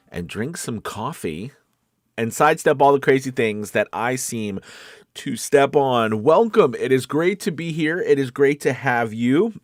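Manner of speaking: usual speaking voice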